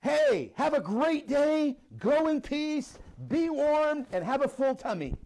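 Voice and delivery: mock sunny send-off; cheery brush-off. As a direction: send them off with a cheerful empty blessing. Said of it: "One guy says".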